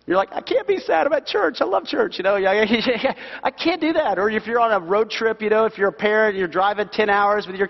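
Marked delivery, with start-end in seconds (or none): 0.3-2.2 high-pitched, hammed-up objection; mock protest. Congregation objecting to being sad. 3.4-4.2 high-pitched, hammed-up objection; mock protest. Congregation objecting to being sad.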